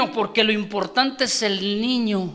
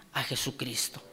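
ordinary talk

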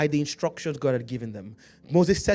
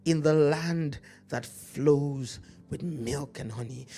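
scripture preaching; 5 dB louder, slower than usual. Acted.

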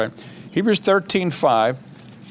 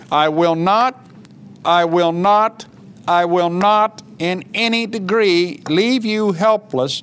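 slow, insistent repeated vows, stressing each phrase; emphatic, drawn-out scripture declaration; 2 semitones higher, slower than usual. Acted. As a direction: drive every promise home. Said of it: "you have to see how emphatic that is".

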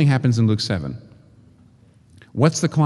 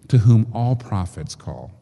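normal talking voice

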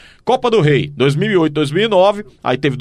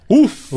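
relieved sigh; 7 semitones higher, 3 dB louder than usual. Real, not acted. Relief after reading long list.

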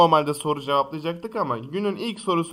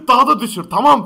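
loud mock quote; 7 semitones higher, 10 dB louder than usual. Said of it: "Ama bağırma, bağırma bak".

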